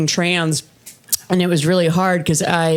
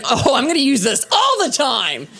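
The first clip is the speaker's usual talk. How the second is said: higher, eager new-owner voice; mock enthusiasm; 5 semitones higher than usual. Comic first getting an air fryer, mock excitement. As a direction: gush as if thrilled with a new gadget.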